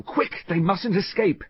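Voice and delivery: high, urgent voice. A narrator's reading. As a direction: push them to hurry. Hazel urging pursuit.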